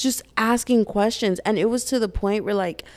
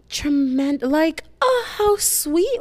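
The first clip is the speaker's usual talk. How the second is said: high-pitched "aww" delight; naive, cooing gratitude; touched first thought; 9 semitones higher, much slower than usual. Retold or imitated. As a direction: gush sweetly, way up high. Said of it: "that was literally my first thought".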